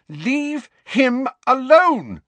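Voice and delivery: loud, very high, drawn-out yell; shout. A narrator's reading. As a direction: shout an outraged order, stretching each word. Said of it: "Lily shouted".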